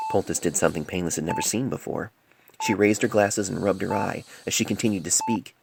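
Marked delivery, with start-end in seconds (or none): none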